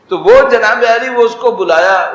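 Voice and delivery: normal talking voice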